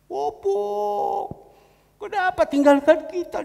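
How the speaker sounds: high, loud mourning wail; wailing cry. Retold or imitated. A Batak mourner wailing at Opung's death. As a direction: cry out to the dead grandfather, loudly.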